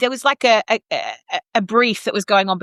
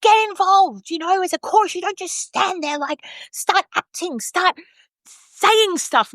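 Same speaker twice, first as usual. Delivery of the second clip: pep up the chorus, pitch raised; urging director; 10 semitones higher, slower than usual. Retold or imitated. Director urging the chorus to get involved, mock pep talk. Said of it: "was like".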